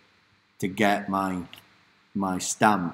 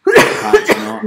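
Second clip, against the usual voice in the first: dramatic; 14 semitones higher, 14 dB louder, slower than usual. Acted. Playful dramatic emphasis.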